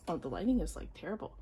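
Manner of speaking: normal talking voice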